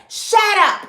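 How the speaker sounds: high and loud, cutting him off; angry snap. Retold or imitated. Arrested woman snapping at the officer, high and loud. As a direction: shut him down hard.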